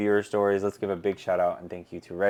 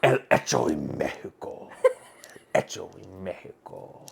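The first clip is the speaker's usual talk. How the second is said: 1 laughing mock Spanish accent. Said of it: "Sounds like Spanish to me".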